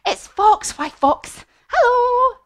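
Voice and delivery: high, loud, excited hail; mouse greeting Fox. Acted. Mouse greeting Fox, high excited call. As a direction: call out a big squeaky hello. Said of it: "the mouse says".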